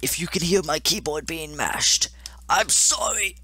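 high, thin, straining squeal; strained high apology. Real, not acted. Apologizing during frantic key mashing.

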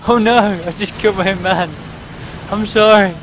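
high, loud outburst of dismay; dismayed apology. Real, not acted. Gamer who killed his own man, high loud dismay. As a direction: cry out sorry in alarm.

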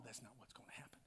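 hushed, breathy answer; whisper. Acted. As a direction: drop to a whisper to reassure. Answers own question, hushed.

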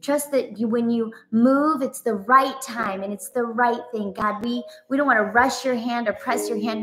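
ordinary delivery

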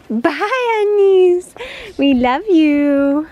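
high, loud sing-song farewell; cooing goodbye. Real, not acted. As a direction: call goodbye to the goats. Farewell to goats.

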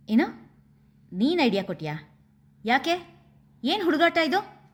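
ರೇಗಿದ ದನಿ. A narrator's reading. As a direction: voice climbing in angry disbelief. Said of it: "ಹುಬ್ಬುಗಂಟಿಕ್ಕಿ ದನಿ ಏರಿಸಿ ನುಡಿದರು".

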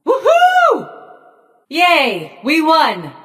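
high, jubilant whooping; elated, high-pitched hurrah; victory cheer. Acted. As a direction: celebrate a win with a high cheer.

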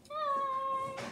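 chirpy high farewell; high sing-song bye. Pretend.

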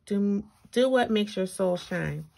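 ordinary talk, nothing marked

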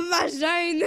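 loud high yell, near tears; laughing shout. Real, not acted.

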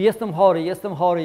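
głośne, jęczące narzekanie na chorobę; zawodzi, że jest chory, coraz bardziej teatralnie. Retold or imitated. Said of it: "przychodzi, mówi". (głośne biadolenie „jestem chory”)

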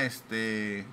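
usual speaking voice

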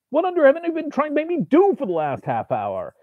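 high, incredulous demand; exasperated outcry. A narrator's reading. Writer demanding answers from the demon, high incredulous voice.

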